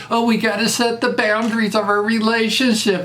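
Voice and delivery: mocking Hollywood types' relationship talk; mocking therapy talk. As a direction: sneer at it by parroting it. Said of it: "they have to do".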